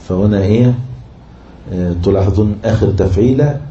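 ordinary talk

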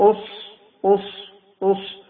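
أص with a thick, heavy ṣ, lips unrounded; emphatic ṣ. Shown as a demonstration. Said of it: "الصاد حرف مفخم".

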